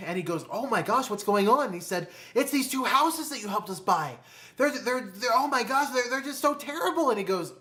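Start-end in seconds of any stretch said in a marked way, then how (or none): 0.5-1.7 acted-out concern, pitched higher; mock-alarmed reaction, voice lifting; alarmed surprise. Mentor to couple who came in troubled, acted surprise. 2.3-7.1 high, flustered complaining with stumbles; hammed-up panicked whine, pitched well up; mock distress. Couple complaining to their mentor about two houses, hammed-up distress.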